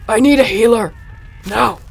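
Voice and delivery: desperate call for a healer; desperate shout. Acted. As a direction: scream out for help, panicked. Shouting for help over injured love.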